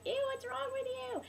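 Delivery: very high imitation of grossed-out children; disgusted kids. Kids spotting the teacher's blistered hands, high mock disgust. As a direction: mimic kids squealing in disgust.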